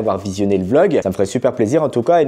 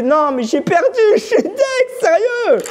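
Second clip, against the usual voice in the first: high, laughing, half-crying; laughing despair; slower than usual. Real, not acted. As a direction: crack up at the loss. Cookie broke, laughing despair.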